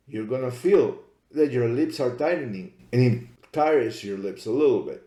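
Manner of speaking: ordinary delivery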